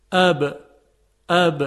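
اب said loud with a crisp release of both lips; b articulated with an emphatic lip release; lip-released b. Shown as a demonstration. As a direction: pop the lips open on اب.

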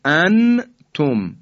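"انتم" with a fully sounded noon, then a separate ta; izhar. Shown as a demonstration. Teacher shows what not to say, clear izhar. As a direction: split "انتم" loud and clear as an-tum. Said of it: "این اظهاره".

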